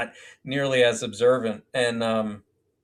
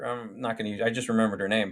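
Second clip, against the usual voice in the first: ordinary talk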